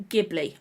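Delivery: "Ghibli" pronounced GIB-lee, falling. Shown as a demonstration. Vlogger listing option three, Ghibli as hard-g GIB-lee. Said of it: "I've never heard it pronounced".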